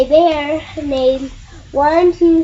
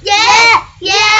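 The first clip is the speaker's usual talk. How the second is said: high, loud repeated yes; excited yeses; 6 semitones higher, 7 dB louder than usual. Real, not acted. Child cheering on the story bears, high and loud. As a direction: bounce out each yes.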